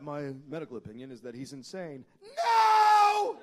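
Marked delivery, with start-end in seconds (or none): 2.2-3.4 shout. Acted.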